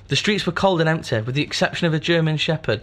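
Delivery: gritty storybook narration; noir detective. Acted. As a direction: read it like a noir opening. Rereads story opening.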